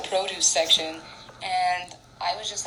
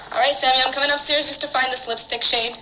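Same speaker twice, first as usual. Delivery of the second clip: louder, higher call across the house; 6 semitones higher, 8 dB louder than usual. Real, not acted. Calling to Sammy while heading upstairs, loud and raised. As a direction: project it so Sammy hears from upstairs.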